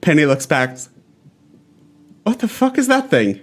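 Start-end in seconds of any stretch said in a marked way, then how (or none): 2.2-3.4 higher-pitched, incredulous question; taken aback, pitch jumping up then dropping; startled. Acted. Herder seeing a flying cat for the first time, startled and higher-pitched.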